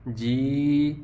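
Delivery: ordinary talk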